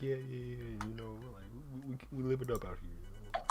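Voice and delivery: very soft, meek imitation; mock polite. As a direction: murmur it like a suck-up to teachers. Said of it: "acts so polite".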